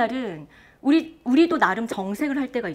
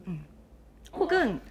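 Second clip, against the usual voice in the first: normal talking voice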